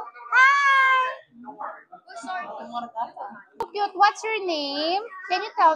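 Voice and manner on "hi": high, sing-song hi; demo for toddler. Shown as a demonstration. Modeling a greeting for a toddler, sing-song. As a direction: show the toddler how. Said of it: "just say like this".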